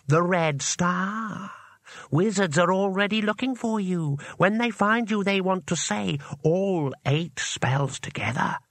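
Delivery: spell's voice. A narrator's reading. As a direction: warn as an ancient spell, stretching the words. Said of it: "said the spell".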